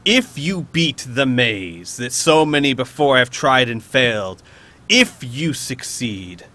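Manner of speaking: very slow, grandiose villain challenge; theatrical monster boast with long pauses; imperious beholder. Acted. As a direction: space out each phrase, punching key words. Beholder sets the maze challenge.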